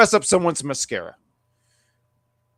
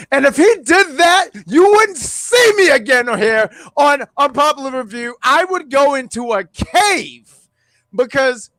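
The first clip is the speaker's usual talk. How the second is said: wrestling promo voice; 8 semitones higher than usual. Pretend. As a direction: cut a wrestling promo, shouting. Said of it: "wrestling promo voice".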